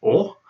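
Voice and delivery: "O" said as a full round open o, as in a stressed Russian syllable. Shown as a demonstration. Teacher showing how a stressed O sounds, round and open. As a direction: give "O" a clear rounded vowel. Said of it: "then it's pronounced O".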